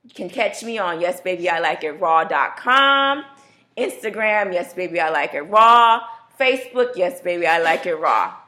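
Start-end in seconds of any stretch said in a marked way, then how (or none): none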